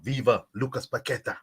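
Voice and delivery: usual speaking voice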